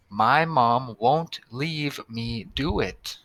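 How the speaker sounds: slowed, careful read of the sentence. Shown as a demonstration. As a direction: read it word by word for the class. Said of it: "let's read the first sentence".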